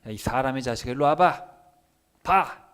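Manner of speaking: acted anger from God; angry summons. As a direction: command him harshly, growing loud. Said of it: "분위기 파악이 되셔야 돼요".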